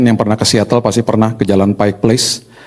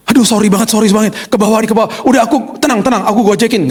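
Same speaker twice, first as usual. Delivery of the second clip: much higher, flustered apologizing wife; istri panik; 11 semitones higher than usual. Retold or imitated. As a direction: squeak out the wife's frantic apology. (istri minta maaf panik dengan suara jauh lebih tinggi)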